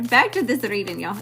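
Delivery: ordinary talk, nothing marked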